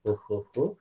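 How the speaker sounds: soft, low ho ho ho, barely pushed; a gentle laugh, just for yourself; light laughter. Shown as a demonstration. Teacher shows how lightly he does it alone, soft laugh. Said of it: "I do very lightly".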